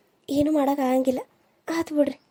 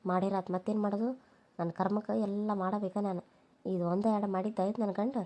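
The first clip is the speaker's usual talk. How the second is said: much softer, grumbling under her breath; muttered grumbling; 2 semitones lower, 11 dB quieter than usual. Acted. Daughter-in-law grumbling alone about making rotti, muttered much softer. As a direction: mutter bitter complaints to herself.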